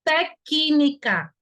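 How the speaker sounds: TÉCNICA very slow, "té... ki-ni-ca"; audible C. Shown as a demonstration. Teacher proving C is not mute, slowly sounded out. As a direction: break TÉCNICA up, C clearly sounded as "ki". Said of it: "Tem até um som com I desse C".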